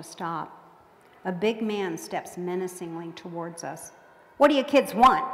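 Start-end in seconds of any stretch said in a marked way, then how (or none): 4.4-5.2 loud, higher, gruff demand. A narrator's reading. Big hobo confronting the kids, gruff and loud. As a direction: challenge the kids roughly. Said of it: "he asks gruffly".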